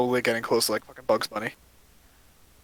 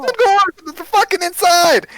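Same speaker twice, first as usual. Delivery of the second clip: furious shout; 9 dB louder than usual. Acted. Dwarf yelling she should have come inside. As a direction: scream it out.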